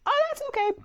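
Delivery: imitating the client. Groomer mimicking her relaxed client, very high and sweet. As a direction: lift way up into the client's breezy tone. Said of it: "She said".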